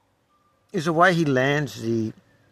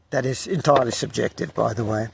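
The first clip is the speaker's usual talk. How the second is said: ordinary talk, nothing marked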